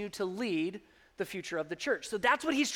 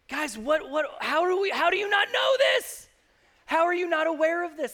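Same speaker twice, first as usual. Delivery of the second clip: much higher, loud disbelief; can't believe they forgot again; exasperated outburst; 7 semitones higher, 4 dB louder than usual. Retold or imitated. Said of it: "we have to always say".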